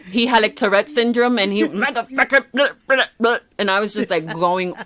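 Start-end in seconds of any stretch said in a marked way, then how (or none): 1.6-3.4 Yosemite Sam rant. Retold or imitated. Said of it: "the cartoon Yosemite Sam".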